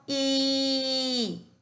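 I held long on a high note; sustained sound demonstration. Shown as a demonstration. Teacher closing the review of the sound, I held long. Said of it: "repaso del sonido".

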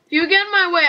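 ordinary delivery

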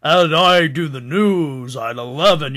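lightly hammed-up anchorman read; fake news voice. Retelling the movie to his cohost, a hint of newsman voice. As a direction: sell the eleven o'clock news, mock smooth. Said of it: "he had that fake news voice".

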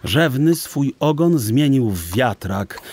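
usual speaking voice

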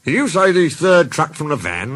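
storybook astonished query; surprised. A narrator's reading. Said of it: "driver and fireman were surprised".

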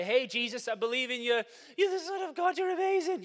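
high, loud gushing devotion; gushing praise. Retold or imitated. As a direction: gush with eager praise. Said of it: "and say".

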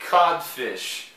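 Codfish said in English, clear and firm; English pronunciation. Shown as a demonstration. Teacher introducing Codfish to the class, clear English. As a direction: model Codfish for students. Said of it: "Essa daqui eu tenho certeza".

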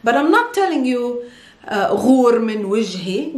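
ordinary delivery

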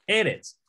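eretz with a high first e, falling after; E-retz, stressed at the front; stress on first syllable. Shown as a demonstration. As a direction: punch the opening of eretz. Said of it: "con énfasis aquí".

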